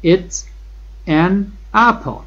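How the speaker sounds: each word set apart, apple lifted high; slow word-by-word drill. Shown as a demonstration. Teacher repeats for the class, slow separated words. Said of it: "این یک سیب است".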